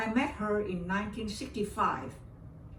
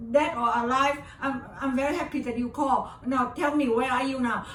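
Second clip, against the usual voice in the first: tearful; 5 semitones higher, 4 dB louder than usual. Retold or imitated. Actress moved on the phone.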